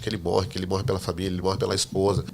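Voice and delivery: ordinary delivery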